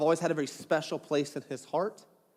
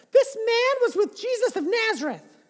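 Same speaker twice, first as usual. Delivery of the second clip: put-on very high, sing-song girl's voice; servant girl voice; 4 dB louder than usual. Second servant girl tells bystanders, very high girlish voice. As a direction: squeak it out like a gossiping girl. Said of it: "She probably totally talked like that".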